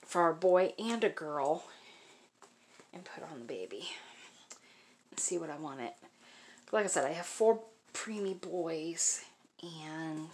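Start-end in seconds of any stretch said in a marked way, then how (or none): 2.9-5.9 whisper. Real, not acted. Quiet aside while unboxing. 6.7-10.3 whisper. Real, not acted. Quiet aside while unboxing.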